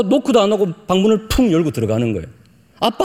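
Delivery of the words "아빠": acted child calling Dad; imitated schoolboy. Pastor's young son barging into his father's office, imitated child's call. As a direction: call out like a boy walking right in. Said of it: "막내 아들이 초등학생이었어요".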